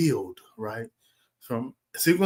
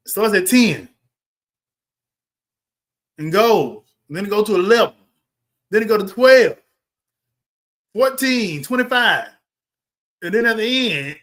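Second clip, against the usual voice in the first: climbing higher and louder with each number; building excitement; 5 semitones higher, 6 dB louder than usual. Real, not acted. Reviewer mocking the film's structure, building higher and louder. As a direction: ramp it up as the count soars.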